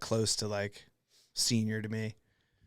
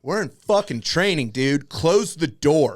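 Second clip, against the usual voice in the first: loud, slow, fed-up order; loud exasperated command; loud annoyed; 5 semitones higher, 10 dB louder, slower than usual. Retold or imitated. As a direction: snap at him to shut the door. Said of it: "I was just like".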